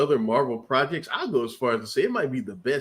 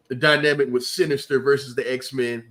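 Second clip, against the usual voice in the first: usual speaking voice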